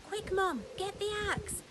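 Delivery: high, loud urgent call; Jack shouting. Acted. Jack calling to his mum for the axe, shouting. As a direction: yell for Mum to hurry. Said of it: "Jack shouted".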